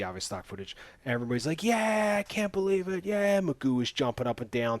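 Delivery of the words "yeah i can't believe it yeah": hammed-up crowd celebration in a raised pitch; mock crowd cheer. Everybody in the stands as the crowd goes crazy, mock cheering.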